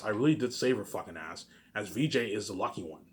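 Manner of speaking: ordinary delivery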